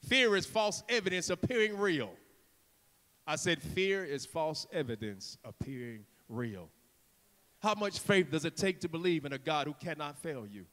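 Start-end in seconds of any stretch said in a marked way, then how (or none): 3.6-6.7 low, soft, deliberate; quiet, weighty emphasis; hushed repeat. Real, not acted. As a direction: drop right down to drive the point home.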